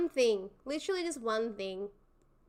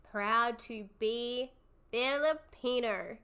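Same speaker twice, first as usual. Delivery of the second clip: slow, stretched proud to be Filipino; drawn out; slower than usual. Acted. Streamer echoing a chat message, drawn out. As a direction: savor each word.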